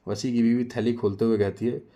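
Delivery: ordinary delivery, nothing marked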